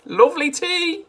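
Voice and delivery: high, loud gleeful goading; gleeful Mister Cranky. A narrator's reading. Mister Cranky urging Grandma to drink, high and gleeful. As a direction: egg her on with glee. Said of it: "grinning hugely".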